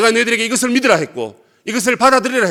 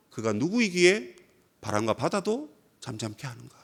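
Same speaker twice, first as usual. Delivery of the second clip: hushed wonder; 7 semitones lower, 12 dB quieter than usual. Acted. Echoing the disciples' awed question.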